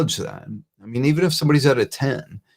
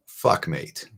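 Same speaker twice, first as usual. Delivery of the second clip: hushed, lower Aussie-style sympathy; quoting an Aussie mate; 6 semitones lower, 5 dB quieter than usual. Retold or imitated. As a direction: lower and softer, a blunt two-word sympathy. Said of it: "He's an Aussie".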